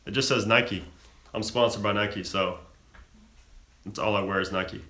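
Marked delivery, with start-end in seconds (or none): none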